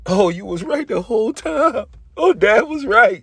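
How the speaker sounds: family's amazed admission. Retold or imitated. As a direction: sing out that Dad was right all along. Family admits Noah was right.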